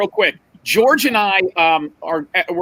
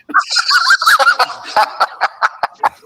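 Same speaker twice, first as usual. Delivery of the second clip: real burst of high laughter; laughing; 3 dB louder than usual. Real, not acted. Telling the popcorn bucket story, bursting into high laughter. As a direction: crack up at your own story.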